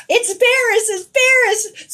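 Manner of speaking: high, loud crowd shout; excited onlookers. Retold or imitated. People watching tell the reader who it is, excited shouting. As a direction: call it out urgently, stretching the second one. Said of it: "the people watching are going".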